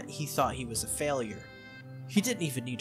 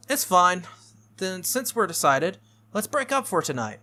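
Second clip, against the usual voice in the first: brisk, higher and louder wrap-up; decisive and loud, pitched up; Tosaka; 5 semitones higher, 7 dB louder than usual. Acted. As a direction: call it a night briskly.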